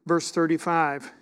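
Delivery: scripture reading. Acted. Reads scripture aloud.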